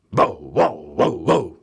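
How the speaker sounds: dog barking. A narrator's reading. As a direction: bark it out, loud. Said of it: "said Bitzer Maloney".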